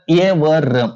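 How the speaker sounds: slow, separated "य व र"; letter by letter. Shown as a demonstration. Teacher isolating the consonants for students, slow and separate "य व र". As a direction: say "य", "व", "र" as three slow separate sounds.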